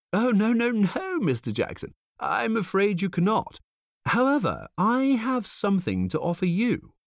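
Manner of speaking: high, silky salesman voice; smooth salesman. A narrator's reading. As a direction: purr the offer. Stranger voice.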